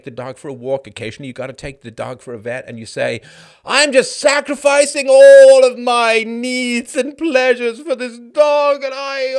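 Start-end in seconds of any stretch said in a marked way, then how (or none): 3.6-9.3 slow, louder, drawn-out self-pity; mock whiny martyr. Mocking dog owner who whines, hammed-up whine. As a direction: ham up the suffering owner.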